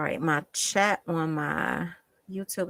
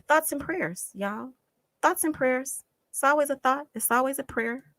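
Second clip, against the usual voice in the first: sarcastic; 6 semitones higher than usual. Real, not acted. Mocking the phrase.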